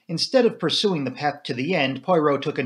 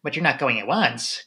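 plain reading voice

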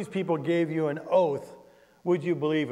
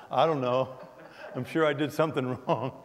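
laughing through the reply; 4 semitones lower, 4 dB quieter than usual. Retold or imitated. Pastor laughs answering the policeman's question. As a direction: chuckle while answering the officer.

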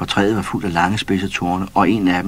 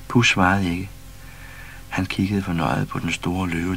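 usual speaking voice

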